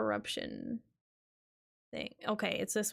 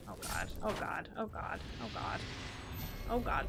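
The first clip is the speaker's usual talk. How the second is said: panicked muttering; 6 dB quieter than usual. Real, not acted. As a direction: whisper it under rising dread.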